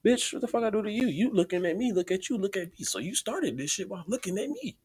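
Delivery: high-pitched, worked-up retort; indignant inner rant. Retold or imitated. Telling off the staring stranger in his mind, indignant. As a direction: fire it off high, outraged and accusing. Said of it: "but in my mind, I'm just like".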